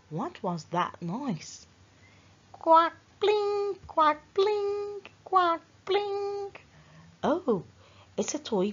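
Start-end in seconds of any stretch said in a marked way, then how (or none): 2.5-6.5 high sing-song quack and bling notes; toy piano sounds. A narrator's reading. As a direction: chime them like toy piano keys. Toy piano noise imitated.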